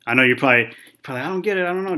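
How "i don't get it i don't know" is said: lightly voiced student complaint, higher pitch; confused student. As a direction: imitate a student giving up, pitched up.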